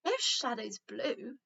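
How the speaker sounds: ordinary delivery, nothing marked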